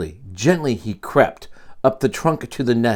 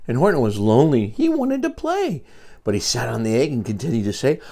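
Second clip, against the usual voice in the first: ordinary delivery, nothing marked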